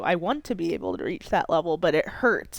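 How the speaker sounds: normal talking voice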